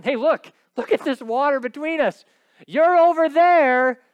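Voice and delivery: high, drawn-out taunting call across the river; mock taunt. Other tribes taunting across the river, mock taunting call. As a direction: call over the water, teasing them.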